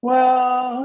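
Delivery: ordinary talk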